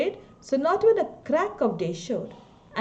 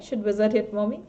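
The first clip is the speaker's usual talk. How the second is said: normal talking voice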